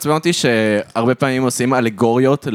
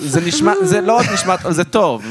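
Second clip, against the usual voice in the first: excited; 9 semitones higher than usual. Real, not acted. Excited insistence.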